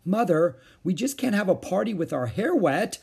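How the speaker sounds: high, pleading wail to her mother; a whiny, aggrieved wail. A narrator's reading. Beezus wailing to Mother about wet hair. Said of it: "wailed Beezus".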